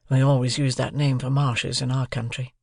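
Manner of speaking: ordinary delivery, nothing marked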